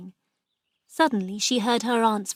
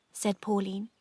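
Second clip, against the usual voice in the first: plain reading voice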